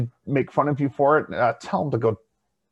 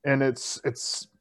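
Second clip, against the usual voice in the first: ordinary talk, nothing marked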